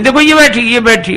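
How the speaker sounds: a bride's shrill accusing insistence; excited pointing. Acted. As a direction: point and insist, she's right there.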